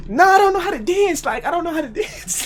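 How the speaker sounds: very high-pitched protest; hammed-up high-voiced refusal; mock panicked denial. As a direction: back out in a squeal, breaking into laughter. Backing out of dancing.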